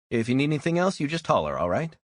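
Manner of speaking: a chirpy diner waitress checking on a customer; friendly waitress. Acted. Waitress asks a tired customer if he's okay, friendly voice.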